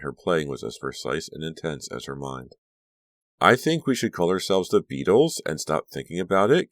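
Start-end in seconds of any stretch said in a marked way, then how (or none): 3.4-6.7 higher, louder playful suggestion; joking. A narrator's reading. Caroline teases about the band name, joking. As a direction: lilt it up like a joke. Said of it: "Caroline joked".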